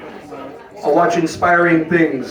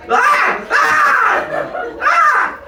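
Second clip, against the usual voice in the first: short, very high mock shrieks; string of piercing party screams; imitated screams; 3 dB louder, much slower than usual. Comic mimicking screaming bachelorette party, high mock shrieks. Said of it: "'Cause you be screaming so much".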